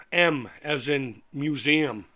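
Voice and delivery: M as in museum, each word slow and deliberate; spelling out the letter. Shown as a demonstration. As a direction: spell M out slowly and clearly, stressing M. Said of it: "No, M".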